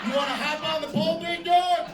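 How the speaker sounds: daring an audience member, loud and fast; taunting call-out. Real, not acted. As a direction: egg him on with a shout.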